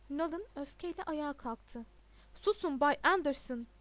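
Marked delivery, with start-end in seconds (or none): 2.4-3.7 loud, stern order; commanding. A narrator's reading. Headmaster silences a protesting pupil, commanding. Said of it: "diye emretti".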